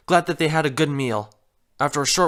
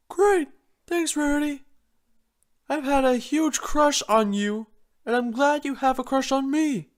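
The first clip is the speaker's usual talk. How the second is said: much higher, eager Spike; enthusiastic Spike; 9 semitones higher than usual. Acted. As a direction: beam with delight. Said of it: "remarked enthusiastically".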